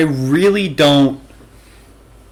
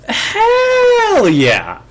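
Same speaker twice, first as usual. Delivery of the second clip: excited shout. Real, not acted. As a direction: yell it out in delight. Excited cheer.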